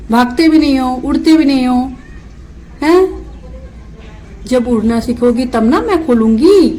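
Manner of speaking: loud, high, coaxing scolding. Real, not acted. Owner lovingly lectures her parrot, loud high baby talk. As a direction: coax the bird to say mamma.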